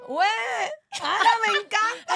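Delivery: high, loud cry of joy; delighted shout. Real, not acted. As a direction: yelp with glee. Reacting to gift.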